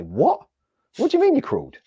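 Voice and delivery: incredulous squeak of a retold friend; mock disbelief. Friends hearing he crawled, mock incredulous high squeak.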